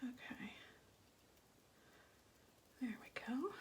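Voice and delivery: whisper. Real, not acted. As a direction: hush it down to a breathy aside. Suddenly hushed while working.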